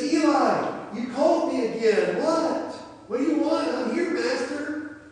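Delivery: very high, loud, insistent answer; worked-up young servant. Acted. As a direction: burst in, hammed-up and puzzled at being called. Samuel runs in a third time.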